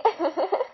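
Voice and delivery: rising unhinged giggle; mad giggle. A narrator's reading. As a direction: giggle at death. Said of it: "I began to laugh again".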